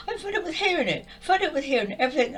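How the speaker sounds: fast, high exasperated rush; fed up, exasperated. Real, not acted. Fed up with the master's blame, rushing high and fast. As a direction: blurt it out, fed up and half laughing. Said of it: "I cried so much".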